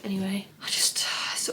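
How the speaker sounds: soft unvoiced whisper to herself. Real, not acted. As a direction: whisper it while fussing with the eye. Fussing with sore eye.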